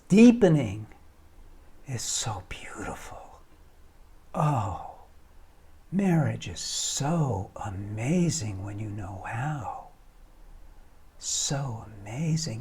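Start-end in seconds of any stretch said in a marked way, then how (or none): 1.9-12.6 slow, awed whisper. Acted. Speaker to viewers, whispering how amazing marriage is. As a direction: whisper it in quiet wonder.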